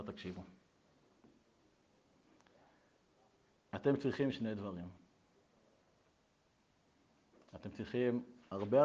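very low and soft; measured psychologist's verdict. Retold or imitated. Psychologist advises the two parents, low and hushed. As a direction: deliver the advice quietly, with weight.

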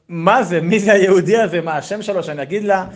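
high, loud, rapid-fire baffled questions; bewildered questioning. Acted. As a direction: demand to know who this is. Rabbi bewildered by the message.

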